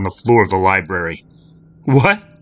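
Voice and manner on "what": higher, louder startled gasp; shocked outburst; girl gasping in shock. Acted. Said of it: "she gasped".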